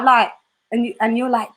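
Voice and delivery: usual speaking voice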